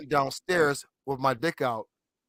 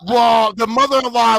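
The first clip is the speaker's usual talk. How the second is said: loud; 5 semitones higher, 7 dB louder than usual. Real, not acted. S0 starts loud over others.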